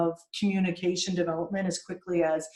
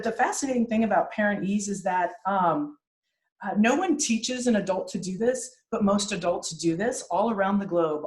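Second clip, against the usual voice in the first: ordinary talk, nothing marked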